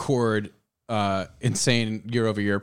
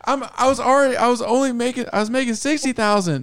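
much higher and louder put-on voice; mock worker negotiating; 6 dB louder, faster than usual. Worker arguing pay with the boss, high mock exasperation. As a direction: argue the salary in a squeaky exasperated voice. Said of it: "It's like".

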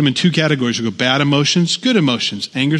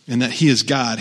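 ordinary talk, nothing marked

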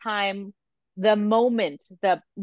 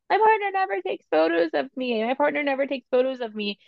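put-on complaining voice, pitched up; mock complaint; 8 semitones higher than usual. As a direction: whine it like a repeated gripe. Voicing a common mothers' complaint.

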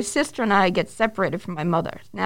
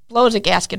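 ordinary delivery

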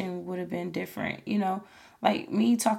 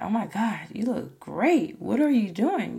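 imitating an impressed friend, lilting higher; amazed admirer; 3 semitones higher than usual. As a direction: gush with swooping pitch, mock amazement. Acting out amazed admirers' compliments.

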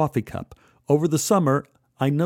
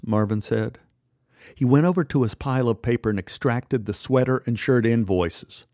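plain reading voice

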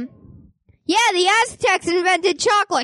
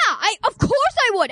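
ordinary delivery

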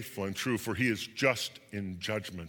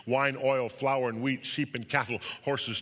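ordinary delivery, nothing marked

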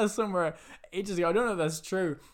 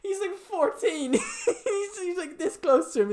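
high, slow, squeaky laughing voice; 11 semitones higher, slower than usual. Real, not acted. Friend pointing out the kid's age, really laughing. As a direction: push it out through real laughter.

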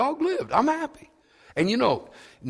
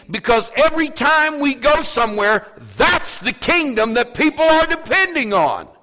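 preaching at full force; 9 semitones higher, 7 dB louder than usual. Acted. Pastor pressing the church on revival, full-force preaching. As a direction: preach it hard to the half-empty church.

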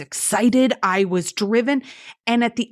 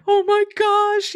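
much higher, slow, drawn out in wonder; amazed gasp; 11 semitones higher, slower than usual. Retold or imitated. Guest recalling her first lay flat seat, high slow amazement. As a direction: stretch it out like you can't believe your luck.

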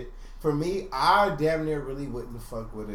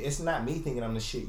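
ordinary delivery

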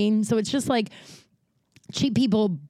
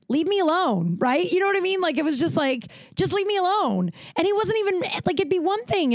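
exasperated rant; 10 semitones higher than usual. Real, not acted. Ranting about the rude man.

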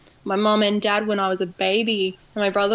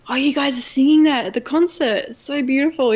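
imitating a friend's gushing excitement, higher pitch; excited friend; 5 semitones higher, 3 dB louder than usual. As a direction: gush like an excited friend, retold. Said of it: "he just got really excited".

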